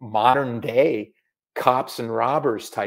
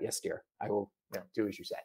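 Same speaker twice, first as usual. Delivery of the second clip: soft, dutiful henpecked reply; meek yes dear; 3 semitones lower, 13 dB quieter, faster than usual. Retold or imitated. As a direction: give in softly like an obedient husband. Meek husband answers wife.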